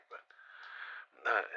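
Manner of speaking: ordinary talk, nothing marked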